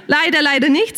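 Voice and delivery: chuckling through the words; laughing. Real, not acted. Preacher laughing at the Moses joke. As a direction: laugh at your own joke.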